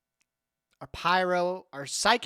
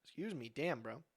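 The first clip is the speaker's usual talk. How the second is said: heartburn, soft and strained; 2 semitones lower, 14 dB quieter than usual. Real, not acted. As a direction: speak through real heartburn. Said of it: "I'm having some weird-ass heartburn right now".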